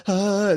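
high, loud drawn-out "aah"; mock freak-out "aah". Stoned kid lying in bed, loud high mock freak-out. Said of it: "like, he was freaking out".

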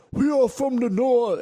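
high, slow, loud fake northern English accent; mock northern accent. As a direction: overblown northern accent, drawn out. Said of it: "I sound like a fucking idiot".

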